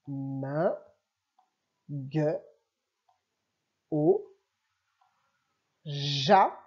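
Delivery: each syllable read alone, clearly spaced; lecture de syllabes. Shown as a demonstration. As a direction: model each syllable slowly for a beginner reader. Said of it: "Deuxième ligne". (syllabes lues lentement, une à une)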